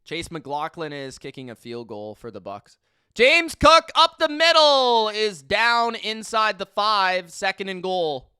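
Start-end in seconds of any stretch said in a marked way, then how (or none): 3.1-8.3 louder, slower announcer-style call of the run; play-by-play call. Acted. Streamer calling James Cook's run for viewers, announcer style. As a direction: narrate the play like a broadcaster.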